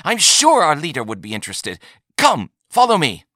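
high, eager urging, rising in force; newfound excitement. A narrator's reading. Buyer inviting trader to meet the leader, excited. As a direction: hurry them along, suddenly keen. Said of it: "said Yalnak with newfound excitement".

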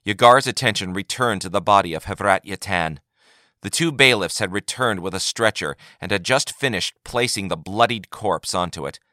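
plain reading voice

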